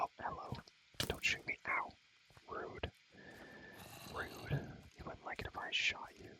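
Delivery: ordinary talk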